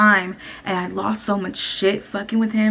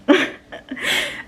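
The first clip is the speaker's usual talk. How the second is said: laugh; 6 semitones higher than usual. Real, not acted. Laughs at own oversharing.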